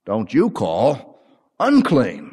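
slow, solemn divine voice; voice of God. Acted. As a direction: lay down the rule slowly and gravely. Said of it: "the voice said".

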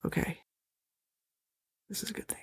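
hushed whisper to yourself. Real, not acted. Relieved aside to self.

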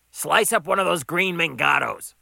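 loud, high boss voice; calling an order. Acted. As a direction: call it through to the kitchen.

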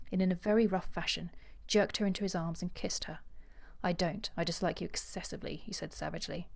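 measured audiobook reading with character voices; composed literary storytelling; story narration. A narrator's reading. Reads novel passage aloud.

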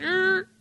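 high imitated tire screech; mock car screech; screech noise. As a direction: mimic brakes squealing.

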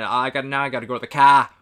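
loud mock Boston accent, R dropped. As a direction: imitate a Boston accent that comes out of nowhere. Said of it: "what happened to his R?".

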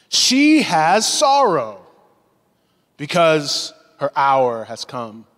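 slow, weighty reading of the verse; drawn-out preacher's reading of scripture. Acted. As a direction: let each phrase land slowly. Preacher reads Jesus' words slowly.

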